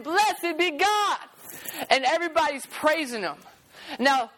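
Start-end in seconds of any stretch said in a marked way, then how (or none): 0.0-1.2 high, slow, ringing praise; joyful crowd praise. Acted. Crowd praising Jesus on the donkey, high and jubilant. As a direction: lift it up like a cheering crowd.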